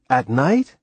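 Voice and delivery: puzzled. A narrator's reading. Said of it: "There was puzzlement in his voice".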